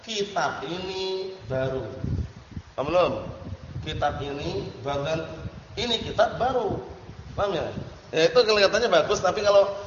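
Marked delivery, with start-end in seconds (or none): 0.0-1.9 slow, softer model translation; measured, drawn-out correct version. Shown as a demonstration. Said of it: "Jadi ngartikannya apa?". 5.7-6.8 higher-pitched mistaken translation; wrong example. Shown as a demonstration.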